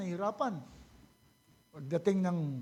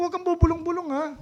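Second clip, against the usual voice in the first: higher, louder and fast scolding; mock angry scolding; 6 semitones higher, 5 dB louder, faster than usual. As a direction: scold the mutterer sharply. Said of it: "sabi ko". (pagalit na saway, ginagaya)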